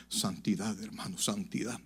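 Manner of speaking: low, soft, solemn "santidad"; quiet solemn pastor. Retold or imitated. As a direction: answer hushed like a grave old pastor. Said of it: "Pastor, mire, ¿usted cómo hace".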